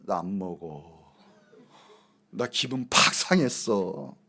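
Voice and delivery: low, slow, dragged-out mock gloom; acted sulking. Sullen guest at the party refusing food, acted sulking. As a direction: play a deflated party pooper. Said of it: "얼굴이 쫙 가라앉아가지고요".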